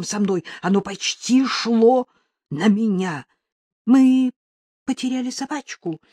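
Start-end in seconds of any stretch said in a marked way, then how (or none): 3.8-6.0 higher-pitched, mildly tearful woman; tearful mumble. A narrator's reading. Jane telling a stranger their dog is lost, tearful. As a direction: explain timidly, a touch of tears. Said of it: "пробормотала Джейн и всхлипнула".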